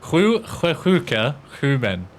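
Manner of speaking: Swedish tongue twister with breathy sj-sounds; imperfect Swedish accent. Shown as a demonstration. Streamer retrying the Swedish tongue twister, imperfect Swedish accent. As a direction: run through the Swedish as a non-native. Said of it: "I don't have a perfect fucking Swedish accent".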